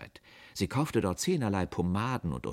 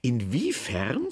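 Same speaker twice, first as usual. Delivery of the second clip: slow, raised, frosty question; icy; 5 semitones higher, 3 dB louder, much slower than usual. A narrator's reading. Aunt questioning the saleswoman, icy and slow. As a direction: draw the word out coldly. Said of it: "forschte meine Tante eisig".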